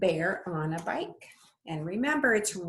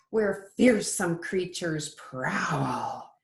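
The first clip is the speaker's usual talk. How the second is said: lilting storybook read-aloud; sing-song picture book voice; reading to children; slower than usual. Acted. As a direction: read it like bedtime story time for little ones. Teacher reads picture book aloud.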